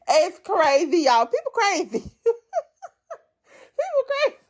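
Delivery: high-pitched, talking through laughter; real laughing. Real, not acted. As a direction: crack up until the words squeak out. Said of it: "I'm laughing because when I think about this".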